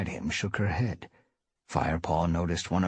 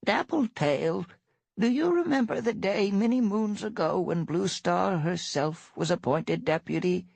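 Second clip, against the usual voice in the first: put-on old cat voice; 13 semitones higher than usual. One Eye old cat voice.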